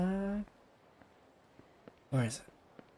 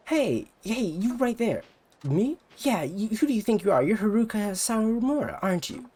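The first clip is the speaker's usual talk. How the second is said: put-on high, pushy rival-girl voice; higher, brassy voice of a jealous idol; bratty rival idol; 10 semitones higher than usual. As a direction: talk down to her like a snobby idol.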